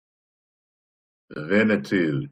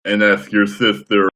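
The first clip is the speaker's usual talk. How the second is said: ordinary delivery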